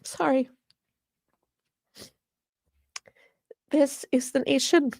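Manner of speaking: tearful, halting, voice lifting with real emotion; emotional. Real, not acted. Activist explaining why she stays in her state, tearfully emotional. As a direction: struggle through tears, stopping to recover. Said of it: "I get so dang emotional".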